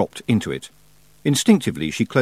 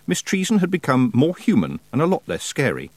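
plain reading voice

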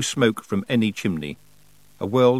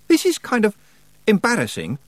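put-on awkward young man; 12 semitones higher, 5 dB louder than usual. Roland speaking.

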